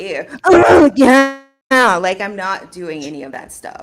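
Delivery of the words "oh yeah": loud put-on moan, pitched up; exaggerated, groaning moan; mock moan. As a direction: belt out a fake moan. Mock moan to prove a point.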